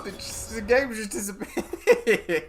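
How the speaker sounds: high squeal dissolving into laughter; slow down and break up laughing mid-sentence; helpless laughter. Real, not acted. Streamer baffled as the game vanishes, helplessly laughing.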